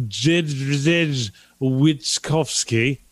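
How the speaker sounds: slow, careful try at Jidrzej Witkowski; careful name attempt. Shown as a demonstration. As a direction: sound out Jidrzej Witkowski slowly, syllable by syllable. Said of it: "name that I can't pronounce".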